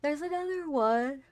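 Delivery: startled high call; surprised exclaim. Real, not acted. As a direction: blurt it out as you spot it. Spotting another body in game.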